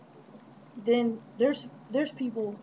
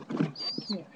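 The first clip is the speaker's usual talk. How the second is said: whispered coaxing. Real, not acted.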